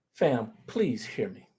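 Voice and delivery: usual speaking voice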